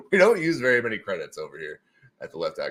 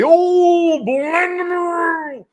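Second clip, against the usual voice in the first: high, loud, slow showman title; grand announcer voice; 9 dB louder, much slower than usual. Acted. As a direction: announce it like a game show. Mock grand title call.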